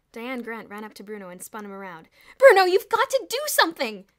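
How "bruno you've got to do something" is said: very high, loud urgent plea; desperate plea. A narrator's reading. Diane spinning Bruno around, pleading loudly. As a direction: beg him frantically.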